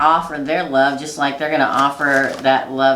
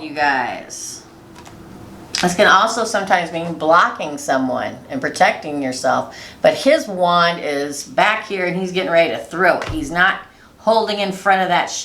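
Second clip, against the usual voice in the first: ordinary talk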